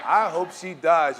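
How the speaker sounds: mock venomous punchline; joking spite with laugh. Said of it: "You know what, Mary Beth".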